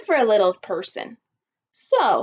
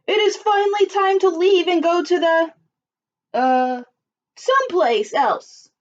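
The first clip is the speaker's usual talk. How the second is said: booming voice; 6 semitones higher, 3 dB louder than usual. A narrator's reading. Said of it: "in his loud, booming voice".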